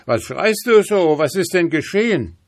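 hammed-up wizard questioning his horse; old wizard. Old wizard asks the screaming horse.

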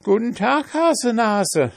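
high, boastful pancake voice; cheeky pancake. A narrator's reading. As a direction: taunt them from high up. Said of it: "sagte der Pfannkuchen".

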